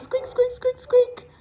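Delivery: high squeaky mouse voice; mouse squeak. Shown as a demonstration. Mimics a mouse for kids.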